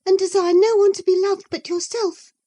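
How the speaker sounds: high, fast and sharp, needling her sister-in-law; young woman's taunt. Acted. Isabella taunting Cathy, high and quick. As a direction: throw the accusation at her.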